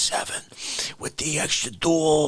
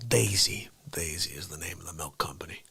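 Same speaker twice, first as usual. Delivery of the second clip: whisper; 14 semitones lower, 9 dB quieter than usual. Acted. Whispered aside.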